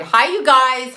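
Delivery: calling out a big hello to the chat; loud greeting. Real, not acted. Greeting viewers, said twice.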